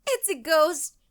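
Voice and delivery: high, loud frightened wail; fearful moan. A narrator's reading. Katie hears thumps from the closet, high fearful moan. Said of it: "Katie moaned".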